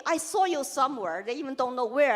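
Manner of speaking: usual speaking voice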